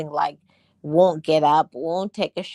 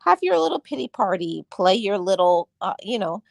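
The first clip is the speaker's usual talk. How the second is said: much higher, sing-song and sarcastic; mocking self-talk; 6 semitones higher, faster than usual. Mocking her own pity party, high and sarcastic. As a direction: tease yourself like a scornful big sister. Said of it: "I say".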